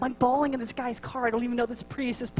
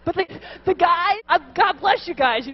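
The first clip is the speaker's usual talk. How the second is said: normal talking voice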